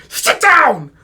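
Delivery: loud, high outburst; imitated angry shout. Fuming student at the door to his teacher, angry shout. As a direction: mimic a student exploding at the teacher.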